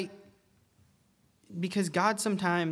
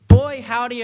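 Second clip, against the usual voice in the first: Southern twang; 7 semitones higher, 15 dB louder than usual. Real, not acted. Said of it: "I don't know why I just said".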